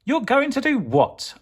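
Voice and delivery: very high mocking "do what?"; teasing hero. Soup Potato mocking the evil pea, high teasing question. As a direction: taunt the trapped pea. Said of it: "asked Soup Potato".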